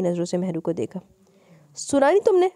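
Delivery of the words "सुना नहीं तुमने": sharp shout, pitched higher and louder. A narrator's reading. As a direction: bark the question at her. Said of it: "वो हीर को ... देखकर चीखा".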